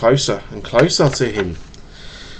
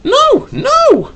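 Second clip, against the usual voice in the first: put-on high pleading child's cry; little boy pleading for his duck. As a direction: beg like a child saving his pet. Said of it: "cried the boy".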